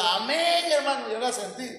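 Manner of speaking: amén agudo y exagerado; fervor imitado. Retold or imitated. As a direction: imita a un creyente muy entusiasta. Acting out emphatic testifying brother. (fervor fingido, tono alto)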